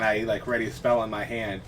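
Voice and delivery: ordinary talk, nothing marked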